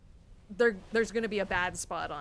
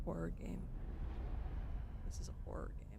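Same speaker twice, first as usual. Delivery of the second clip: spooky unvoiced whisper; 13 dB quieter, much faster than usual. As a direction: hush it like a ghost story. Spooky whisper before scare.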